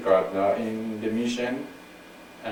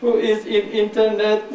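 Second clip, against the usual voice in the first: higher robot voice breaking into a laugh; 11 semitones higher, 5 dB louder than usual. Pretend. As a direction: stiff robot voice, half laughing. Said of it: "I can even talk like a robot".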